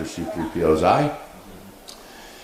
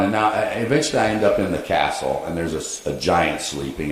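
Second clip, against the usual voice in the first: ordinary talk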